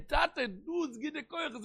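very high, pleading call; high acted outcry at little extra volume; acted cry to God. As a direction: cry out to a father, pitched far up. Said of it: "גיסט דער געשריי".

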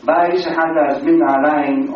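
usual speaking voice

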